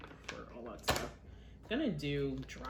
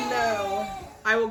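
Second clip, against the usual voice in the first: loud, fast, half crying; teary from chopping onions; 7 semitones higher, 12 dB louder than usual. Real, not acted. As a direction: push through tears. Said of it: "I had tears in my eyes".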